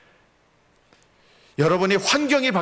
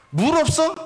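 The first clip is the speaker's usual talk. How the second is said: sharp high disbelieving question; annoyed surprise; 13 semitones higher, 4 dB louder than usual. Acted. Israelite finding no water, high and annoyed. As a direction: snap it out, put out.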